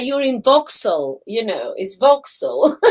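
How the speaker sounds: "Vauxhall" said as VOX-ul; corrected pronunciation. Retold or imitated. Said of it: "it's Vauxhall".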